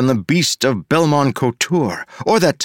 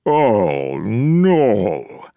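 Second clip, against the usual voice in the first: groaning. A narrator's reading. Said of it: "He groaned deep within his corpulent body".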